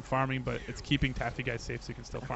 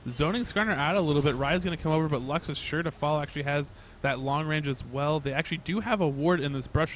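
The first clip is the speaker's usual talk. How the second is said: usual speaking voice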